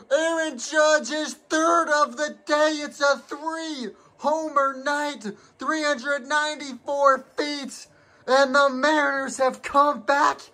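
high, ecstatic broadcaster yelling the walk-off, often slowed and drawn out; breathless home run call with laughs breaking through; excited play-by-play call. Acted. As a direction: call the game-winning grand slam like a stunned TV announcer.

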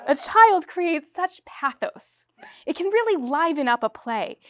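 slow, high, gushing pitch; gushing director. Acted. Star director gushing to the playwright about adding a child, slow and high. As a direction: savour every word of the sales pitch.

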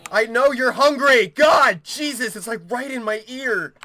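high, rattled yelling that eases off; exasperated shout. Real, not acted. Player complaining it's right in the ear, exasperated shout. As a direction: shout back, fed up and jumpy.

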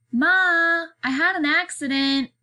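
put-on high, loud child's call from indoors; young child calling. A narrator's reading. Child calls Ma about an accident, young voice. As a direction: call out high like a little kid. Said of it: "a young voice calls".

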